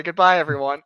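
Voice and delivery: normal talking voice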